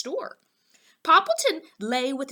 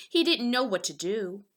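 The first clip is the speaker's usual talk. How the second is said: ordinary delivery, nothing marked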